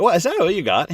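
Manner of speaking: high, loud teasing challenge; mock taunt. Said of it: "He's like".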